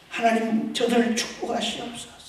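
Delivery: normal talking voice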